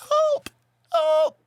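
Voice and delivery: very high, thin cry for help; mock screaming. Retelling the Titanic door scene, high mock scream.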